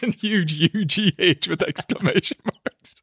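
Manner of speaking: high, slow, laughing through the words; helpless amusement at a harsh note. Real, not acted. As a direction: spell it out while cracking up. Laughing at the critic's scathing note.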